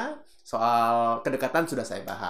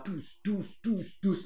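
high, rhythmic thumping noises; punchy vocal beat sounds; imitated music beat; 7 semitones higher, 4 dB quieter, much slower than usual. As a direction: mimic a pumping backing track. Imitating the pumping backing music.

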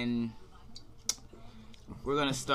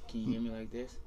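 ordinary delivery

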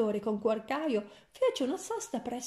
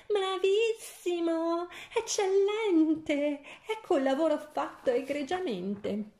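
gleeful shout; 7 semitones higher than usual. A narrator's reading. Queen praising the hunter for the heart, shouting with glee. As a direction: crow it out in triumph. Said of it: "E lei gridò".

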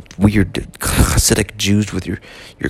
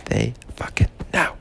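whisper; 5 dB quieter than usual. Acted. Confiding aside.

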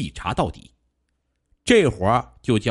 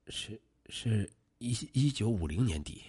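small voice; 11 dB quieter than usual. A narrator's reading. Suspect answering the policeman's question, in a small voice. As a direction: answer haltingly, barely above a murmur. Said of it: "老卢小声地说道".

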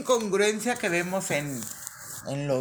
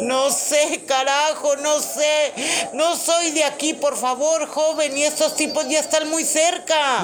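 loud, high, frantic plea; panicked caller; 8 semitones higher, 6 dB louder than usual. Acted. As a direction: beg for help in a panic. Skit, desperate caller.

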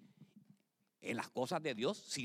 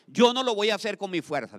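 louder declaration; 4 semitones higher, 11 dB louder than usual. Shown as a demonstration. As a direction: call it out louder the second time. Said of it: "dígale".

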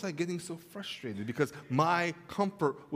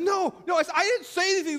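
higher, fast defensive denial; defensive protest; 11 semitones higher, 5 dB louder, faster than usual. Retold or imitated. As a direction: deny it in a rush, higher. Defensive self in quarrel.

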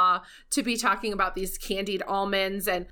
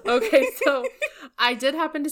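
laughing; 6 semitones higher, 4 dB louder than usual. Real, not acted. Laughing after friend's joke.

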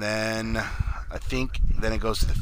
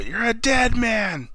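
Bobby yelling; 10 semitones higher than usual. Retold or imitated. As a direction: stretch the threat out in a high mock yell. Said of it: "Bobby kept yelling".

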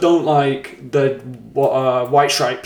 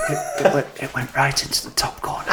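whispered goal description, soft; ASMR whisper; 6 dB quieter than usual. Pretend. Hosts trade whispered football ASMR commentary. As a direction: keep the ASMR whisper going. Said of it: "football A S M R".

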